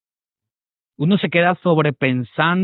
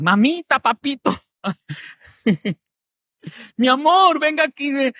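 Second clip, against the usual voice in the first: high, coaxing vendor calling customers in; sweet-talking vendor; 9 semitones higher than usual. Retold or imitated. Pastor imitating a flattering seller calling customers, high and coaxing. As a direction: lure the buyer with high sugary pet names.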